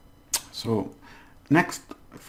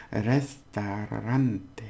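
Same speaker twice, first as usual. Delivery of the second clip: slow careful pronunciation; much slower than usual. Shown as a demonstration. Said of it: "It's a difficult word".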